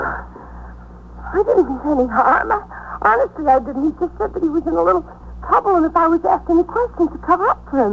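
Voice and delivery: she begs them to believe her; tearful pleading. Acted. Scared witness pleads innocence to detectives, high and hurried. Wanda pleading after the fight.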